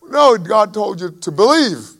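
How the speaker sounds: ordinary talk, nothing marked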